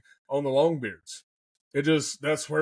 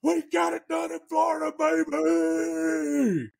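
much higher, jubilant holler; hooping and hollering; 14 semitones higher, slower than usual. Retold or imitated. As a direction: whoop it out like you just won. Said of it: "I started hooping and hollering".

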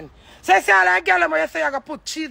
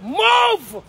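higher, louder shouted command; angry shout; 6 semitones higher, 4 dB louder than usual. Real, not acted. Ordering her husband out of her way, sharp angry shout. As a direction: bark it like an order.

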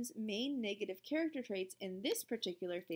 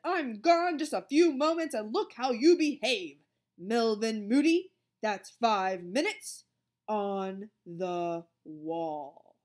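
loud scolding, slowing to a drawn-out sentence; stern telling-off; 9 dB louder, slower than usual. A narrator's reading. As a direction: scold loudly, then slowly pronounce the punishment. Monitor scolds and punishes Melvin.

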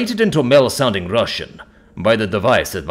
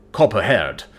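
ordinary delivery

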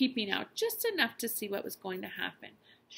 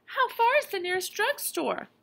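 much higher, louder frantic mother; anxious mother; 13 semitones higher, 5 dB louder than usual. Acted. Mother asking the father about the drugstore, high and urgent. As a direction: press urgently for an answer.

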